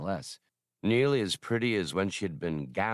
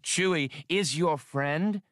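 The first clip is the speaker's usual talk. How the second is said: put-on a young woman's voice, a touch higher, scolding; scolding Hasty; 8 semitones higher, slower than usual. A narrator's reading. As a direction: tell him off, exasperated, pitched up.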